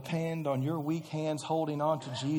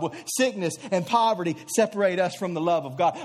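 ordinary delivery

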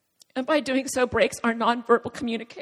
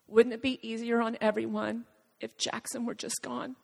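soft, even, almost alluring voice; calm, soothing; 2 semitones lower, 6 dB quieter than usual. Retold or imitated. Tempting voice to the mother at the clubhouse door, calm and soothing. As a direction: soothe quietly, as if gently tempting. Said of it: "this very calm, soothing, almost alluring voice say".

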